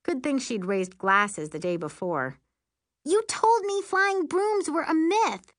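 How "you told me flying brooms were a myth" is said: very high, slow, stretched-out protest; indignant cry. A narrator's reading. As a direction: cry out an outraged accusation. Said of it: "Miri cried".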